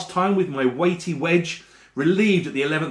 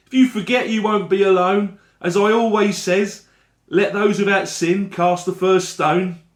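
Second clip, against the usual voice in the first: higher and louder, gently forgiving seller; kindly street seller; 6 semitones higher, 5 dB louder than usual. Acted. Seller quoting scripture to the narrator, higher and kindly. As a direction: absolve him with a cheery wink in the voice.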